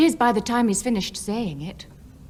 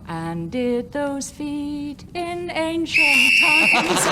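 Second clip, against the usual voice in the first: grand declaiming; 3 semitones higher, much slower than usual. Acted. Mock grand recital of Jerusalem.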